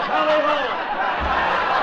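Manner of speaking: long, drawn-out high holler; hammed-up, slow, loud yell. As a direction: stretch the cry out loud to the dogs. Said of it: "I cracked the whip and yelled".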